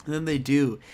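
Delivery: normal talking voice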